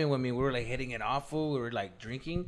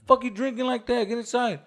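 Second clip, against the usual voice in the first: husband scolding; 9 semitones higher than usual. Retold or imitated.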